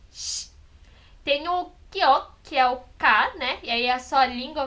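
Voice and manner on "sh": "sh" as a soft, breathy hiss with no vowel; sh sound. Shown as a demonstration. Teacher showing the articulation of the sh consonant, softly. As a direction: hold a quiet "sh" with the tongue raised. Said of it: "que é esse sh".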